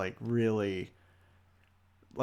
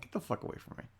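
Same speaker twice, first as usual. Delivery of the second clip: hushed, breathy brush-off; whispered get-away; 11 dB quieter, faster than usual. Retold or imitated. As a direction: whisper it like a muttered curse. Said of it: "'cause I'm like".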